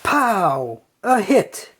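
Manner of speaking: plain reading voice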